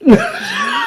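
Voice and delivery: very high squeal of laughter. Real, not acted. Laughing at the anime scene, squealing laugh. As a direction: let a shrill laugh burst out.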